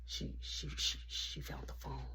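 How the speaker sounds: stammering voiceless whisper. Retold or imitated. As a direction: whisper it fast and nervous, don't get caught. Hiding talk from mom.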